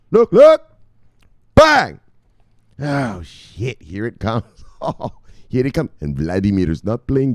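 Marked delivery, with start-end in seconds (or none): 0.1-2.0 high, loud, punchy catchphrases; hyped shouting. Real, not acted. Host hyping viewers for the big news, shouted. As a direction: yell it out to the crowd.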